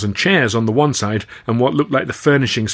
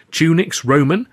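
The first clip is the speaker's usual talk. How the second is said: plain reading voice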